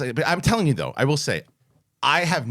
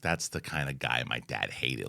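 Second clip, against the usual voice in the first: low, slow, much softer remark; wife's aside; 12 semitones lower, 10 dB quieter, slower than usual. Retold or imitated. Wife commenting on a bodybuilder, quiet low aside. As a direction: murmur a disdainful aside, slowly. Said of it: "she's like".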